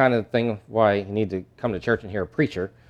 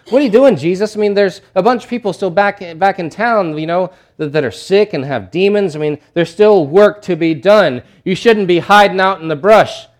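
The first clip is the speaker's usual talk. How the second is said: higher, louder exasperated scolding; annoyed disciples; 6 semitones higher, 6 dB louder than usual. Retold or imitated. Disciples scolding Jesus for hiding, exasperated and loud. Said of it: "you can just hear the annoyance in their voices".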